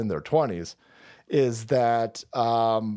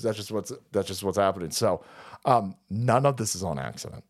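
normal talking voice

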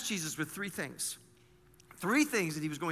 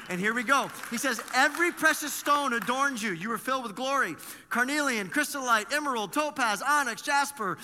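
louder, higher, ringing sermon read; preaching at full force; 6 semitones higher, 5 dB louder than usual. Acted. Pastor reading God's praise of Satan's splendor, full-force preaching. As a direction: proclaim each gem name out loud.

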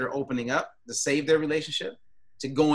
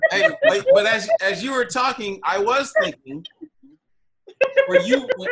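high, loud, laughing through words; 11 semitones higher, 8 dB louder than usual. Real, not acted. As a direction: talk while still laughing. Laughing after being teased.

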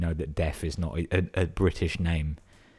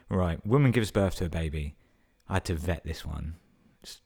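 ordinary delivery

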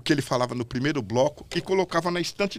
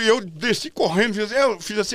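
normal talking voice

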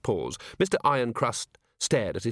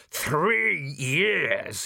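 slow, raised, outraged; furious rant; 4 semitones higher than usual. A narrator's reading. Baker restrained by two employees, furious. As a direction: seethe over each word.

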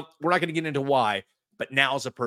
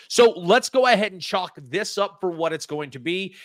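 normal talking voice

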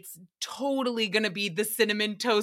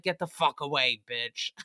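mock gruff brush-off; 9 semitones lower than usual. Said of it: "And I'm like".

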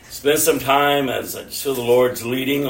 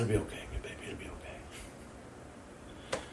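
put-on whispered comfort to a child; soothing reassurance; 3 semitones lower, 18 dB quieter than usual. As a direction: murmur it very softly, almost without voice. Father reassures his child.

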